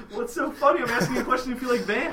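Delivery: high, loud, laughing tease; a teasing question through laughter. Real, not acted. Teasing friend, laughing loudly.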